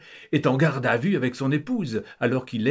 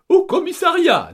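much higher, loud commanding call; decisive countess; 9 dB louder than usual. Acted. Countess deciding destination for family, high loud command. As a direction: declare it, no discussion. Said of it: "décide la comtesse".

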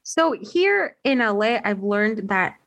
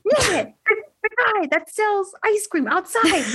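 high, exasperated explaining; 10 semitones higher than usual. Retold or imitated. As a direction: insist like nobody gets it, pitched way up. Exasperated retelling to cousins.

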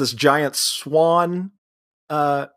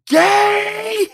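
very high, loud drawn-out scream; imitated scream; 5 dB louder than usual. Daughter screams at her dad about Peter, imitated scream. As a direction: scream the one word, stretched and shrill. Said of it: "Just screams at him".

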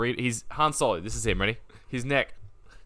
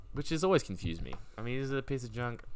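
ordinary talk

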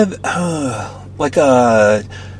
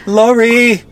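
high playful call; 12 semitones higher, 5 dB louder than usual. Real, not acted. Teasing name-calling back and forth.

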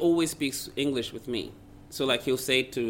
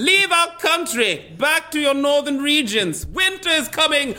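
mock banishing shout; 9 dB louder than usual. Comic retelling of being kicked out of the country, mock banishing shout. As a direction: boom it out like a melodramatic proclamation.

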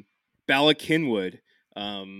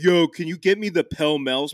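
louder, higher mock Philly ask; Philly customer; 5 semitones higher, 7 dB louder than usual. Imitating himself ordering cigarettes at a Philly Wawa. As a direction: call out the order like a local at Wawa. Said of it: "be like".

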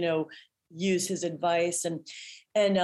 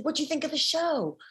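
much higher, bright and eager; eager question; 9 semitones higher than usual. Retold or imitated. Performer asking her customer friend about the show, much higher and eager. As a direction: fish for a compliment with excitement. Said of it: "and I said".

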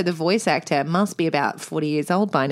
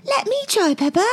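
much higher, sweet and smug; a cheeky piggy-friend voice going up high; Susie Sheep voice; 11 semitones higher than usual. Retold or imitated. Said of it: "And then Susie goes".

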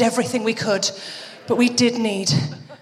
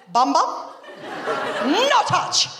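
put-on toddler's Spanish accent; slower than usual. Two-year-old daughter following the cat around, mock Spanish-accented scolding. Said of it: "she's got a Spanish accent".

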